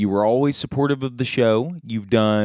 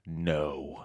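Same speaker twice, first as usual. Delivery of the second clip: low, hushed little answer; whispered reply; 8 semitones lower, 13 dB quieter than usual. Acted. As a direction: drop to a low, very soft murmur. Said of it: "He'll whisper back".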